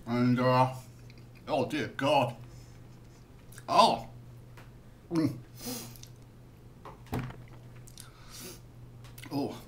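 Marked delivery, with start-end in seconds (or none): 1.5-9.5 wincing groans at the sourness; pained sour reaction. Real, not acted. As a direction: react to a mouth-puckering sour taste. Reacting to extreme sour candy.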